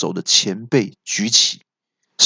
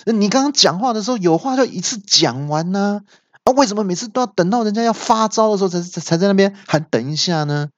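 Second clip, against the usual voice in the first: high-pitched, exasperated telling-off; scolding; 10 semitones higher, faster than usual. A narrator's reading. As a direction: scold in a raised, hurried pitch. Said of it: "恶怪就骂说". (高声气急地骂)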